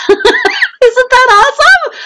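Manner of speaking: high, drawn-out gush through laughter; delighted laughing. Real, not acted. Author shows off skeleton sticker to viewers, laughing with delight. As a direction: laugh into a squealed question.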